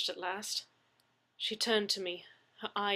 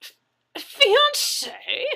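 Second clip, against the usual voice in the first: shrill shocked squeak far higher and louder; stammered shock; 16 dB louder, much slower than usual. Acted. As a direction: choke out the word in disbelief.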